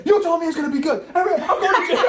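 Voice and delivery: high, loud panicky pleading; mock panic, voice shooting up; imitating a friend freaking out; panicked friend. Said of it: "J D's goes".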